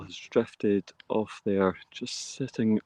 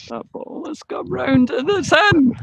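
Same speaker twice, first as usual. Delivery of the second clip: much higher and louder as the putt drops; excited call; 9 dB louder than usual. Real, not acted. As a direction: shout it in as the ball drops.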